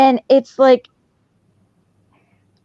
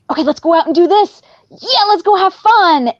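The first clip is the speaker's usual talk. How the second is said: higher, bright-eyed cheer to a dog; upbeat coaxing; 7 semitones higher than usual. Retold or imitated. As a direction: pump the dog up for fun.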